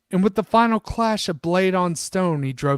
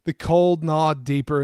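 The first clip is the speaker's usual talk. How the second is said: normal talking voice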